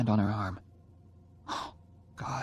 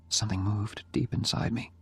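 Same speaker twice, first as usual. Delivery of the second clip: plain reading voice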